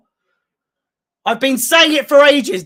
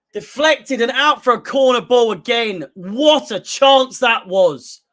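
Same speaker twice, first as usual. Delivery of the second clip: ordinary delivery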